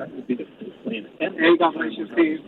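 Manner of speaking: speaking from across the room; far from mic. Real, not acted. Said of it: "it sounds like you're across the room".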